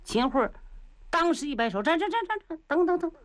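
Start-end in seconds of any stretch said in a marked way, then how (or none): 1.8-3.1 high, urgent repeated calls to wait; hurried stop. Acted. Minister frantically calling the captive back, high and urgent. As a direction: call him back in a rush.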